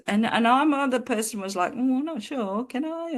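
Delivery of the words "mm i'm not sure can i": high, timid, unsure little voice; meek doubt. Retold or imitated. Her doubtful self answers, high, meek and unsure. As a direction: shrink back from the claim. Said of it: "pretty pathetic with that one".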